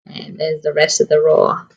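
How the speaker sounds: lively storybook narration for a class; reading to children. Acted. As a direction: read it like story time on the carpet. Reading picture book aloud.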